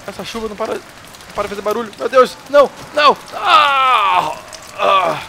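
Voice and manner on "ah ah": loud high scream; startled scream. Real, not acted. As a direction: let out a sudden scream. Screams in game scene.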